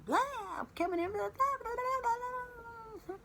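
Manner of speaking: high, sing-song blah-blah parody; mock the nagging wife; mocking chatter. Mocking wife's nonstop venting. (imitando a mulher tagarelando, bem aguda)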